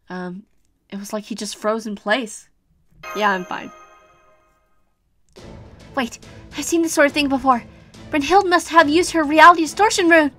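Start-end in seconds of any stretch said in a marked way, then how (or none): 6.0-10.3 alarmed realisation. Acted. Girl realises.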